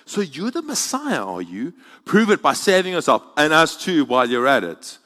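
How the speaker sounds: scoffing. A narrator's reading.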